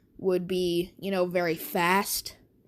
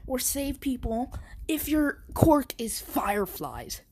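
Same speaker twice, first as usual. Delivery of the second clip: higher, sneering bully tirade; taunting bully voice; 5 semitones higher than usual. Acted. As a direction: belittle him like a cocky bully. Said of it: "Bakugo says".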